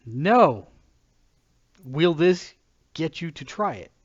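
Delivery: normal talking voice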